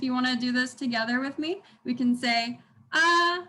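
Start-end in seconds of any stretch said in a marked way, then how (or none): 2.9-3.4 AH held long, high and level; steady, level "ah"; first tone. Shown as a demonstration. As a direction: sustain "ah" on one unchanged pitch. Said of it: "The first tone is".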